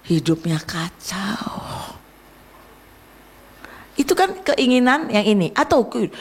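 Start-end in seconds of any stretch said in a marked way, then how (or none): 0.1-2.0 slow, whispered verdict; slow whispered warning about a ruined life; grim whisper. Acted. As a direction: let it drop to a dark whisper. Dramatic aside on a ruined life.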